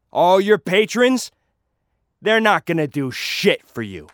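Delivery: loud, high taunting challenge to a rival; addressing the crowd. Acted. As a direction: call out the rival in front of the whole crowd. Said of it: "I speak into the crowd".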